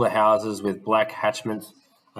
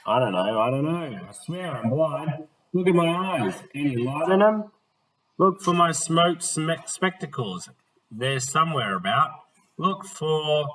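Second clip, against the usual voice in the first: higher, urgent pleading; pleading old gent; 6 semitones higher than usual. Acted. Blind gentleman pleading with Smith to see his eyes, higher. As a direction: beg him to look for the spectacles.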